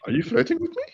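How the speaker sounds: high, playful mock-flirty squeal; flirty teasing banter. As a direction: tease like a flirty texter. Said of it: "It's like".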